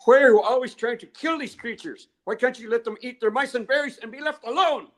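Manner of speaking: high, loud scolding cry of an indignant elder woman; high-pitched indignant scolding; angry elder scolding. Retold or imitated. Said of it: "this is what she said to me".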